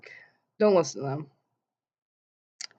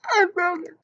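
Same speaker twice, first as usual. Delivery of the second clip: much higher alarmed cry of I broke it; panicked blurt; 12 semitones higher than usual. Shown as a demonstration. How not to tell mom about the tooth, high panicked blurt. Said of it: "You don't be like".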